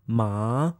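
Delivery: MA starts low and rises; rising tone. Shown as a demonstration. Teacher demonstrates Chinese sounds, rising tone. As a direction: lift MA upward like a question. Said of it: "And the Chinese sounds".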